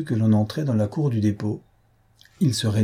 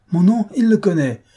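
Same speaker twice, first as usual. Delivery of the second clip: high, loud, proud assertion; imperious command; 10 semitones higher, 6 dB louder than usual. A narrator's reading. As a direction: insist forcefully on your own renown. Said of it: "mais comme des commandements militaires".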